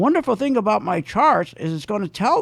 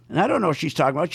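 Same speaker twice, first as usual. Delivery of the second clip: ordinary talk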